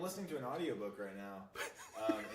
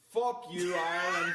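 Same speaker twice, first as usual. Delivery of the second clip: mock shout; 5 semitones higher, 8 dB louder than usual. Mock sign-off insult shout.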